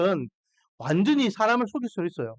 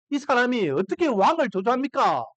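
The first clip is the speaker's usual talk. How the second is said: usual speaking voice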